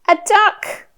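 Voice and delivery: put-on high-pitched toddler-directed delight; excited naming. Shown as a demonstration. Showing a little one the duck, excited. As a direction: pop it out high, like a find.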